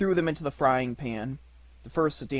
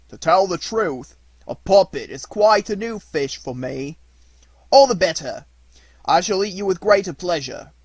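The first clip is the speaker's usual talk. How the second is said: fisherman's voice; 4 semitones higher, 8 dB louder than usual. Acted.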